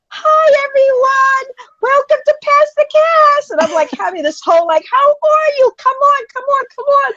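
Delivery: hammed-up cheerful livestream host; overly cheerful host welcoming viewers to the live show; bubbly host greeting. Going live as broadcaster host.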